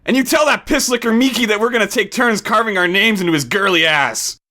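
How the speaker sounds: high, menacing thug threat; sustained high snarl. A narrator's reading. Thug threatens Joe's friend through him, snarling. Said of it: "he snarled".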